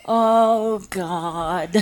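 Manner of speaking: groaned words breaking into laughter; mock dismay dissolving into giggles; laughing dismay. Acted dismayed reaction, laughing.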